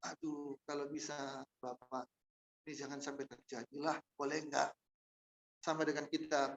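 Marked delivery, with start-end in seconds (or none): 0.0-4.7 soft, humble plea to the Father; quiet plea. Retold or imitated. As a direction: ask gently, almost under the breath. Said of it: "Dan dia bilang".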